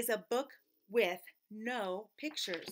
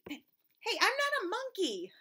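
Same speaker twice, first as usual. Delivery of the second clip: mock protest; 9 semitones higher, slower than usual. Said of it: "That is not true".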